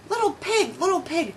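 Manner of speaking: a hint of a wolf coaxing at the door; wolf calling. A narrator's reading. Wolf at the door calls to the pig, sweet and high. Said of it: "says the wolf".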